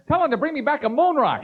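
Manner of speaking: put-on high, childish pestering voice; Dan Quayle. Vice president begs from behind the president, childishly eager. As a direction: pipe up like an eager kid. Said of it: "Quayle's behind them".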